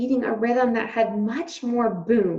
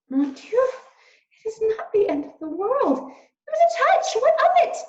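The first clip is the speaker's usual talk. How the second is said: high French mouse mother, much higher; a fussing French mother, pitched way up; 14 semitones higher, 6 dB louder than usual. A narrator's reading. Despero's mother voiced by reader.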